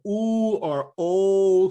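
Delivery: "oo" and "o" held long, lips forward; rounder, darker sound. Shown as a demonstration. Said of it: "almost darker sound".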